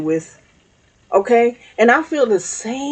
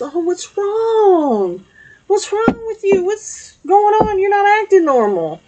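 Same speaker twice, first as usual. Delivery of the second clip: nosy concerned friend; 10 semitones higher than usual. Retold or imitated. Someone cornering an upset person to ask what's wrong, high prying voice. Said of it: "Somebody comes up to you and says".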